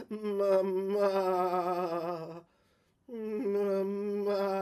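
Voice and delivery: long drawn-out call of Mama, distant and hollow; a faint spectral child calling Mama; echoing ghost voice. Acted. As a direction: call it like an echo through cell walls. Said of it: "the echoes in the walls of the cells".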